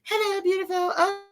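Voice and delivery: high, sugary fake-friendly coo; imitating a phony new girl in a high voice. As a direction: gush fake compliments up high. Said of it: "phony with this high-pitched voice".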